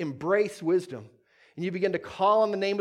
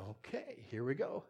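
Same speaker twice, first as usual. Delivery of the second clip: very soft, low answer; quiet, unruffled reply; 5 semitones lower, 14 dB quieter than usual. Acted. God answering a panicking believer, very quiet and unruffled. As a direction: drop right down to an understated murmur. Acting God's calm reply to the complaint.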